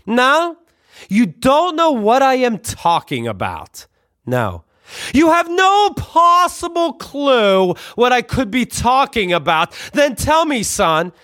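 sarcastic dad grilling. Retold or imitated. Father grilling his son about the bag, high and mocking. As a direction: drag out the disbelief like a hammy father.

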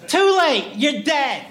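high, punched exclamations; abrupt game-over cries; triumphant yell. Acted. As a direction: cut in with high, emphatic verdicts. Robot cuts off the slow human.

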